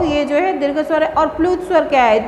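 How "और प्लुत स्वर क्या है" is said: ordinary talk, nothing marked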